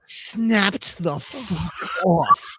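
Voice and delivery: slow, laughing through it. Real, not acted. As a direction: laugh out the words. Laughing at own mishap.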